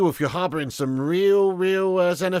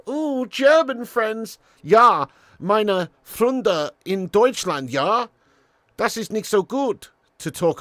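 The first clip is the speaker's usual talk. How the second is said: slow, higher hammed-up German accent; mock German accent; 9 semitones higher, 8 dB louder, much slower than usual. Streamer teasing chat in a mock German accent. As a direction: put on a jokey German voice.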